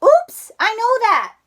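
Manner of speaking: high, loud startled cry. Acted. Froggy caught sawing off his branch, crying out. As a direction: yelp it out. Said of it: "cried Froggy".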